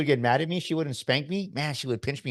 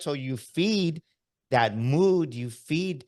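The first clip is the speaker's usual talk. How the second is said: slow emphatic; 4 semitones higher, much slower than usual. Real, not acted. Repeats for emphasis.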